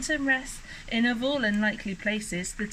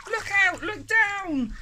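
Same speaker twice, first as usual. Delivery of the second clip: high, raised-voice alarm; yelled warning; 10 semitones higher, 4 dB louder than usual. A narrator's reading. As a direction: call out an urgent warning. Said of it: "who yelled".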